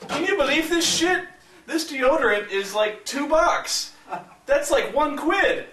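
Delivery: high-pitched retold outrage; indignant disbelief. Friend complains to him about deodorant price, indignant and high. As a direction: imitate an appalled shopper, voice jumping up high.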